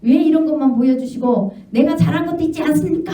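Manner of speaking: high, indignant protest; a hammed-up complaining wife; arguing with God. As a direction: demand to know why only the bad is shown. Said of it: "막 하나님 앞에 잘한 거 있다고 따진 거예요".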